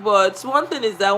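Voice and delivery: ordinary talk, nothing marked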